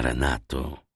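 calm, tender. A narrator's reading. Wilford calms Renata.

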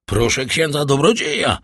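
ordinary delivery

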